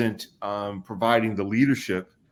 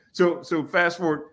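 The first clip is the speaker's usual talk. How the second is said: ordinary talk, nothing marked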